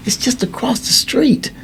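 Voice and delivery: put-on high, slow voice of a pleading young boy; a boy asking him to stay. Boy Joey pleading, voiced by teller.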